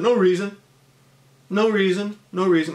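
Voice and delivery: high, loud, flustered no reason; defensive denial. Retold or imitated. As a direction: brush it off, caught out. Kid self denying, caught.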